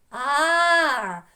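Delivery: "А" held long, loud and high; stressed vowel. Shown as a demonstration. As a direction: belt out a sustained "А" for the class. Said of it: "На другий склад ставимо наголос".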